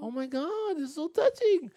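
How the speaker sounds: very high, hammed-up tearful voice; acting choked up. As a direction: play overcome by emotion, pitch way up. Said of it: "I was choked up".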